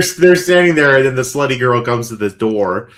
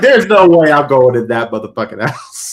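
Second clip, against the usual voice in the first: loud, hammed-up refusal; mock emphatic refusal; 5 dB louder than usual. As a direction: refuse loudly, as the movie character.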